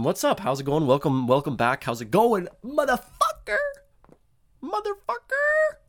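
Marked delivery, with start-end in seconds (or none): 2.6-3.6 high, playful mock-tough greeting; cheeky high-pitched hello. Shown as a demonstration. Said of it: "I say motherfucker". 4.6-5.8 very high, slow, stretched squeal of the word; squeaky drawn-out greeting. Shown as a demonstration. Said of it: "I say motherfucker".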